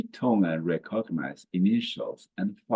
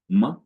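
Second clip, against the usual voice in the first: "Ma" very short and quick; neutral tone; 3 semitones higher, 6 dB louder than usual. Shown as a demonstration. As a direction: clip "Ma" to one brief beat. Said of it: "soft and quick".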